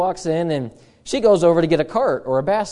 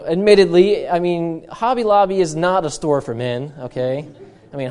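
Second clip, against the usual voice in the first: ordinary talk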